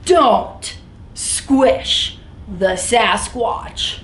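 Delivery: very slow, every word stretched; slow, deliberate storybook refrain; drawn-out refrain. Acted. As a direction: drag the title refrain out for effect.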